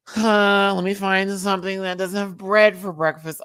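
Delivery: high, loud exasperated huff. Retold or imitated. Hunting for breakfast without bread, exasperated. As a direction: act out a fed-up search. Acts out frustrated hunt for breakfast.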